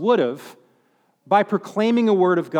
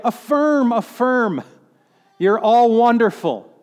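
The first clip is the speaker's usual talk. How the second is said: higher, mock-cheerful affirming; 4 semitones higher, 4 dB louder, slower than usual. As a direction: gush it like a flatterer. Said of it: "he does not say".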